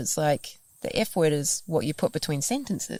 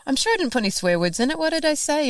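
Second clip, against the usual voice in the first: high, fast baffled what what; baffled protest; 9 semitones higher, faster than usual. Retold or imitated. Answering the reader about swearing, high baffled protest. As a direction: protest in confusion. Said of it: "I said".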